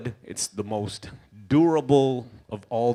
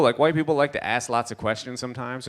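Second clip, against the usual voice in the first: ordinary delivery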